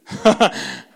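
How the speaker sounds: short loud laugh; a quick burst of laughter. Real, not acted. Laughs at his own correction.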